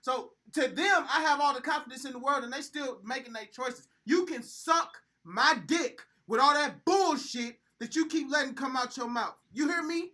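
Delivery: loud, high heated rant; berserk rage. Real, not acted. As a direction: rant hard at friends. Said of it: "You're yelling".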